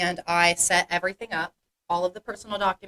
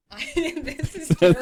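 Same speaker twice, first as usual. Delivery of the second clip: laughing; 10 semitones higher than usual. Real, not acted. Embarrassed by the height question.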